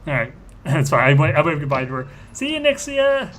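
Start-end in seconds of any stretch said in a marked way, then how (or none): 2.3-3.3 high, slow, sing-song taunt; mocking farewell. Villain waving goodbye to the fleeing girl, high mocking voice. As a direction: wave her off in a falsetto tease.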